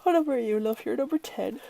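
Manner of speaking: usual speaking voice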